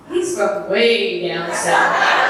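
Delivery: slow, laughing through the line; laughing sly answer. Retold or imitated. Daughter teasing her mama, laughing slowly.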